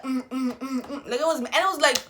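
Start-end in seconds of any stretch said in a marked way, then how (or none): 0.0-1.1 mock angry chewing hums, laughing; laughing mm while chewing. Eating cereal while mad, laughing hums. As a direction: hum mid-bite, mad and amused. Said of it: "You're just like".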